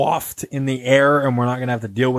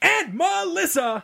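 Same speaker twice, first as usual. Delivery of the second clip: drawn-out, sky-high name announcement; over-excited host introduction; high-energy host; 4 dB quieter, slower than usual. Real, not acted. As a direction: stretch the name out in a big hype shout. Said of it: "[name] has so much energy tonight".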